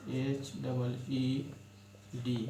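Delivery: letters spelled out very slowly; teacher spelling each letter slowly for pupils, slow and deliberate. Shown as a demonstration. शिक्षक की तरह धीरे-धीरे वर्तनी बोलना. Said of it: "हीड है".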